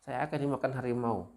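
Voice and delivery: teriak minta tolong. Retold or imitated. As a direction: act out the farmer's tiger alarm, calling high. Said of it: "dia teriak lagi". (teriakan peragaan bernada tinggi, minta tolong)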